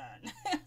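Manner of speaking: a quick amused chuckle; short laugh. Real, not acted. Laughing at Anais's comeback. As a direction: chuckle at the drama.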